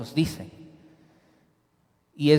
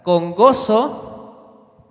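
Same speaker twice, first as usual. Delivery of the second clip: "gozo" drawn out very slowly, higher and louder; emphasis on con gozo; 6 semitones higher, 5 dB louder, much slower than usual. Shown as a demonstration. Preacher stresses the key phrase for the congregation, slow and lifted. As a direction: stretch and lift "con gozo". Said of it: "Y énfasis en la última frase".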